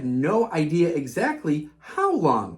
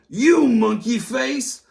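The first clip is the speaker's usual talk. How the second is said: a slow, loud jeer; mock him with a slow sneer; comic book bully jeering at Rhythm; taunting bully; 4 semitones higher, 4 dB louder, slower than usual. A narrator's reading.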